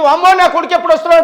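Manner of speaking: retold parental fretting; mock anxious parent. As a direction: voice a parent's worry in a hurried high pitch. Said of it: "తల్లిదండ్రులు అభద్రతా భావంలో బతుకుతా ఉన్నారు".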